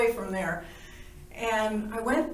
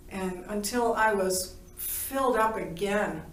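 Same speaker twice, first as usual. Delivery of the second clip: normal talking voice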